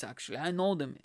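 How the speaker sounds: normal talking voice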